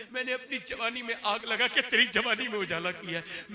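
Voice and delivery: lightly voiced aging father, pleading; a hint of a grieving father; old father's lament. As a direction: let the father pour out his hurt. Voicing the old father's plea.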